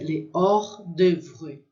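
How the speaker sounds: slow, careful French "les hors d'oeuvre"; careful French pronunciation. Shown as a demonstration. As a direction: sound out "les hors d'oeuvre" slowly, silent H. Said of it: "essa palavra é bem difícil".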